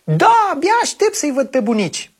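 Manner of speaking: put-on child voice. Copil vorbește în poveste.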